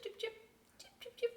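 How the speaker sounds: high chirping bird call; imitating a cardinal's chip note. Shown as a demonstration. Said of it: "It goes chip".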